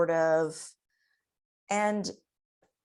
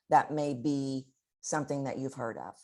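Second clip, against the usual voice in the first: normal talking voice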